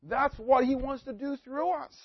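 mounting sermon fervour; rising exhortation. Acted. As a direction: lift them up into the call.

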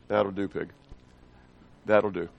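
a low, quiet farmer's line; lightly voiced gruff farmer; understated farmer praise, low; farmer quote. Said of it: "Donald Sutherland looks down and says".

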